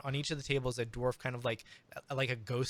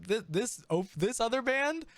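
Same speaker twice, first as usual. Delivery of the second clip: much higher, louder astonished crowd; amazed crowd; 9 semitones higher, 4 dB louder than usual. Acted. The crowd going bonkers over the bard's showmanship, astonished. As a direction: crowd shrieking in disbelief. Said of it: "they're like".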